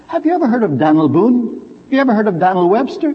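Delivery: Daniel squeezed to Dan'l in one syllable; Daniel as one clipped beat Dan'l. Shown as a demonstration. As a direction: say Dan'l, American style. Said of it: "pronounce it as one syllable".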